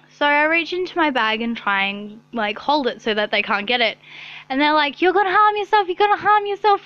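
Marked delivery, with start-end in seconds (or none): 5.0-6.9 high, loud, hurried mock alarm; hammed-up frantic staff warning; mock panic. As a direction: imitate staff freaking out, pitched up and loud. Staff panicking over the knife.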